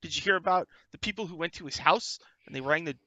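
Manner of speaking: put-on high excited young reporter voice; eager young reporter. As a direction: sell it like a keen cub reporter. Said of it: "Jim Lee says".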